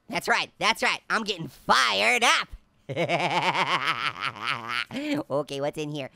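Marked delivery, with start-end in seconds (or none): 2.9-5.2 long low cackle; villainous cackle. Real, not acted. Player is fired up for revenge, villainous cackle. Laughs after getting fired up.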